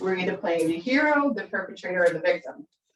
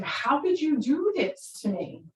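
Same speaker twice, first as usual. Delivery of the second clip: wounded victim; 6 semitones higher, slower than usual. Retold or imitated. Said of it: "I jump back into victim".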